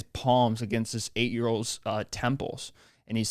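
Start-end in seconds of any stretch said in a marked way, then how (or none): none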